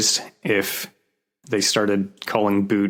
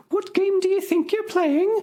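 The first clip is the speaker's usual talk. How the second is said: much higher falsetto lady voice; much higher mock lady crying out after the dustman; cross lady; faster than usual. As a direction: squeak it like an indignant old lady. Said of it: "she cried".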